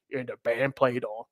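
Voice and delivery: deep, quiet bass-singer voice, badly imitated. Host imitating The Temptations' deep voice guy, bad low impression. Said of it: "I know it's a bad impression".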